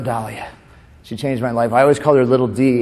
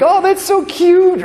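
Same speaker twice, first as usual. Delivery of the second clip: very high, drawn-out imitation swoon; mock gushing; 6 dB louder, slower than usual. Imitating ladies charmed by Eric's line, exaggerated gushing. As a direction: coo it slowly, way up high. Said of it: "you'd be like".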